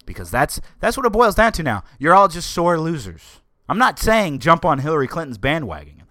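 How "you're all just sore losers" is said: loud, high jab at sore losers; scornful raised voice; scolding shout. Real, not acted. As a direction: yell it at the crowd. Ranting at protesters.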